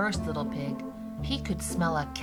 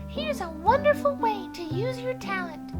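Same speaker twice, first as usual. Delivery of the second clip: very high piggy voice; high-pitched, helpful little pig; encouraging little pig; 13 semitones higher than usual. Acted. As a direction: encourage the wolf as a tiny pig. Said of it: "said the third little pig".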